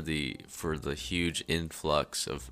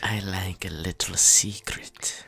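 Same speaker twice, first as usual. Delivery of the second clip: playful secret whisper. Acted. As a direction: whisper it slyly.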